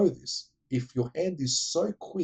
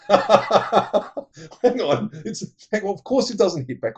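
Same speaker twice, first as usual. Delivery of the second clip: laughing; 7 semitones higher, 6 dB louder, slower than usual. Real, not acted. Laughing at the film.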